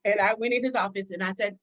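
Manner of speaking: usual speaking voice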